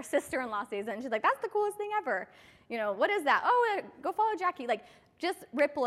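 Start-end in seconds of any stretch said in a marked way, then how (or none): 1.2-2.3 high, delighted gushing; excited gush. Retold or imitated. Sister-in-law spotting the hack pack, high excited gush. 2.9-4.7 excited. Retold or imitated. Sister-in-law asks about hack pack.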